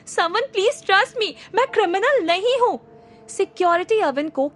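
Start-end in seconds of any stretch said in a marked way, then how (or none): 0.0-2.8 screaming. Acted. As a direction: scream it as they drag him. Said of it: "Avin फिर जोर से चीखा".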